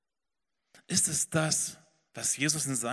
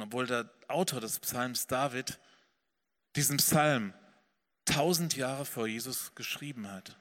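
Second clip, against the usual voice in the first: usual speaking voice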